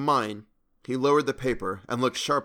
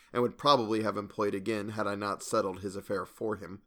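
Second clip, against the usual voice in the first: plain reading voice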